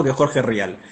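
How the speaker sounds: usual speaking voice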